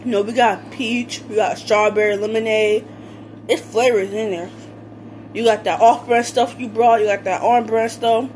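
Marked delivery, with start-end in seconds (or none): none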